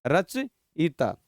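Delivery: "रचयिता" stretched out, each syllable apart; very slow pronunciation. Shown as a demonstration. Teacher stressing an exam word, "रचयिता" very slowly. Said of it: "पूछ लेते हैं ऐसे बहुत बार".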